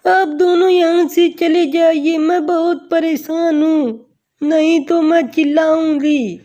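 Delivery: a touch of pleading warning; distressed young woman. Acted. Girl warning two harassers away, mildly distressed. Voices Ravina warning off the rogues.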